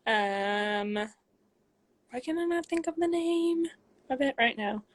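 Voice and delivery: ordinary talk, nothing marked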